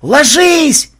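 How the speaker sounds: very high, loud warning yell; split-second alarm shout; shouted command. A narrator's reading. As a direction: scream for everyone to drop. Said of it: "рефлекторно скомандовал кто-то".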